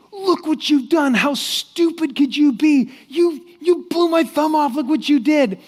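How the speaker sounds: high, loud outraged rant; furious king. Acted. King to the servant who shot off his thumb, furious. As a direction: yell it like an enraged king berating his servant. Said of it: "the king was furious".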